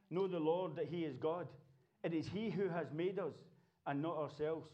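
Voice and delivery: usual speaking voice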